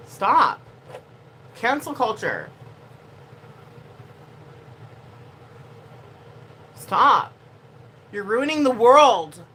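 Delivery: loud, firm stop then slow scold; exasperated scolding. Real, not acted. Streamer scolding cancel culture, loud and exasperated. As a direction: tell them off, fed up.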